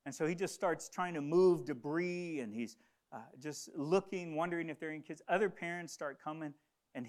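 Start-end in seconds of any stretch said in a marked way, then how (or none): none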